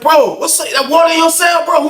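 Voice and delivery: high, urgent questioning; calling out, alarmed. Retold or imitated. Inmate asking neighbour about water, high alarmed call.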